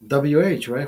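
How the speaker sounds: ordinary talk, nothing marked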